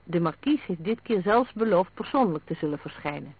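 ordinary delivery, nothing marked

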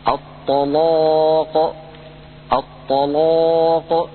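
slow recited الطلاق with a heavy لام; الطلاق drawn out, لام full and heavy; Quranic recitation. Shown as a demonstration. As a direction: chant الطلاق, thick on the لام. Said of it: "هذه اللام فخمها ورش".

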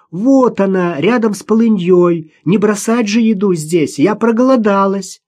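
put-on high-pitched child calling; daughter's voice. A narrator's reading. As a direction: call from afar like a young daughter. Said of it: "услышала она голос дочери". (дочь кричит высоким голосом)

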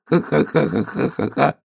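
high, slow acted "ha-ha-ha"; drawn-out high laughing tail; piglet's laugh. A narrator's reading. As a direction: giggle out the end of the song. Piglet laughs ending his song.

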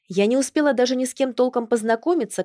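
ordinary delivery

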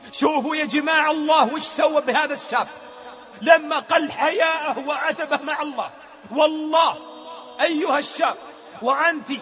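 rising, urgent sermon voice; fervent preaching. Acted. The preacher admonishes the young, fervent. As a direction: warn them with fire.

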